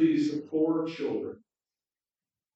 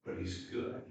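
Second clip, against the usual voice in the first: hushed, softer, almost whispered; reverent whisper; 7 semitones lower, 8 dB quieter than usual. Acted. Mr Beaver assuring the children, softly whispered. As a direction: breathe it with awe.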